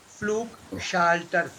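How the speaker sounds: Flugschalter very slow, syllable by syllable; slow-motion teaching of Flugschalter; careful model. Shown as a demonstration. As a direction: stretch out Flugschalter with long U and open ah.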